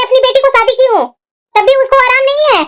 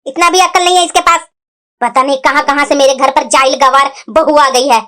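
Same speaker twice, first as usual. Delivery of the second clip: much lower-pitched scornful grumble; disgruntled grumbling; 9 semitones lower than usual. Acted. Mother-in-law complaining about her daughter-in-law, grumbling.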